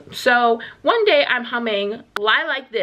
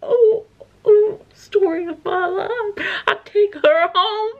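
high, teary-laughing wail; overwhelmed singalong; 10 semitones higher than usual. Real, not acted. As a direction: wail along high, overcome. Singing along emotionally.

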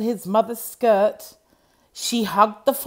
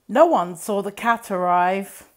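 ordinary delivery, nothing marked

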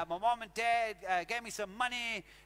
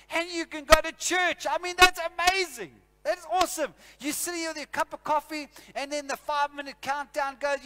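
loud, high mock enthusiasm; preacher mocking home church; sarcastic hype; 6 semitones higher, 11 dB louder than usual. Real, not acted. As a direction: oversell it for the irony.

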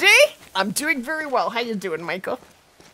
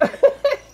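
squealing laugh; 11 dB louder than usual. Real, not acted. Laughing at the cat.

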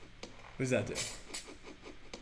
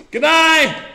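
high, loud hammed-up farewell; mock goodbye yell; 12 semitones higher, 12 dB louder than usual. Gamer voicing his falling character, shouting goodbye. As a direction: fling it out as you drop.

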